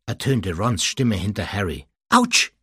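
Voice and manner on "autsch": sharp high gasp of pain; gasped ouch. Acted. Hermine gasps in the dark train, sharp pained yelp. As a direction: yelp as your foot is trodden on. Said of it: "keuchte Hermine".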